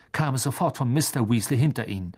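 ordinary delivery

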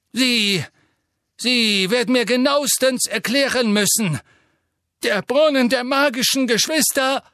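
high, loud, nervous bluster; flustered minister. A narrator's reading. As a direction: bluster to regain control. Fudge voiced in audiobook.

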